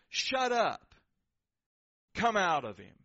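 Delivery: loud, forceful order; commanding shout. Acted. Jesus orders the spirit out mid-ministry, commanding shout. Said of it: "Jesus points back there and says".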